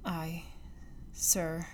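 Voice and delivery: hushed, nearly whispered confession; altered hushed voice. A narrator's reading. Old recluse starts his own story to the guest, hushed. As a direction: drop to a bare murmur as the confession begins. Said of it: "added with an altered voice and manner".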